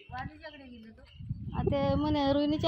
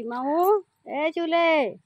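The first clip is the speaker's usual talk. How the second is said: louder call with a rising end; 3 semitones higher, 7 dB louder, much slower than usual. Real, not acted. Woman calling for her missing pet, loud call.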